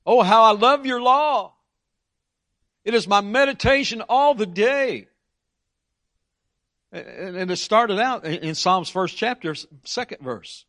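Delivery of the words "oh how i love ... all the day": raised, louder scripture reading; psalm reading. Acted. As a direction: lift the psalm up with devotion. Reading psalm aloud in sermon.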